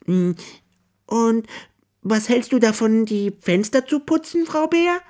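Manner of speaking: high, eager hare voice. A narrator's reading. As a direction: jump in keenly with the idea. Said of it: "meint Herr Hase eifrig".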